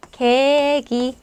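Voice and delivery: 개기 slowly, with plain untensed g in both syllables; exact soft g. Shown as a demonstration. Teacher showing learners the exact sound, slow careful 개기. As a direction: draw out 개기 as two clear syllables, no w glide. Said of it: "정확하게 개기라고 여러분께서 발음을".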